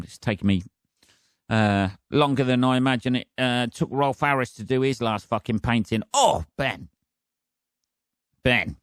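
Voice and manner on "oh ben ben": scolding himself. Real, not acted. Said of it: "Why are you mentioning that?".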